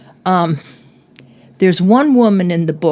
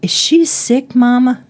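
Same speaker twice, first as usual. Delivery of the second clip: put-on child's voice; 6 semitones higher than usual. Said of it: "he asked his mother".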